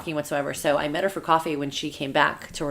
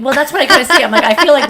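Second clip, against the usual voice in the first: loud, high laugh; 9 semitones higher, 12 dB louder than usual. Real, not acted. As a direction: laugh through the words. Laughing at the comparison.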